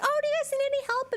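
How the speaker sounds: high, quick shop-assistant voice; imitated sales clerk. Sales clerk approaching two shoppers, imitated high-pitched helpfulness. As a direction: mimic an eager offer of help. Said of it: "this lady comes over".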